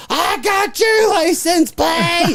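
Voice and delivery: high, slow mock shout; guard yelling. Guard yelling after the fleeing truck, high and slow. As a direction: yell it like a furious guard. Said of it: "I hear him yelling at me".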